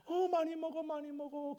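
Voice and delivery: high, indulgent parent voice; doting parent. Retold or imitated. Parent urging a child to eat, high doting voice.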